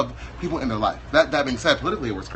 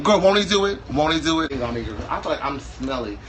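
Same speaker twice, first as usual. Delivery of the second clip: usual speaking voice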